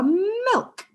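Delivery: "milk" with a long hummed m; drawn-out "mmmilk", rising; held first sound. Shown as a demonstration. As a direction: stretch the m of "milk" so children hear it. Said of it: "So does milk start with".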